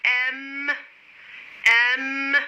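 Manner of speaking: "M" with a long, held hum at the end; teacher modelling "M" clearly, stretched out; sounded-out letter. Shown as a demonstration. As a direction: hold the m sound of "M". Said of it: "كرروا مرايا أولادي".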